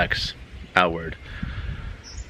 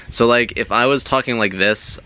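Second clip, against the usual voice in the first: higher, strained throat-talker voice; demonstrating a tense, raised voice; 4 semitones higher than usual. Shown as a demonstration. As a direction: talk up from the throat, chest tight.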